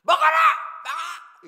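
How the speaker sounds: explosive high-pitched shout; full-force mock Gyeongsang-do yell; acted angry yelling. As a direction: bellow at them like a furious diner. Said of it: "소리 지르는 건 좀".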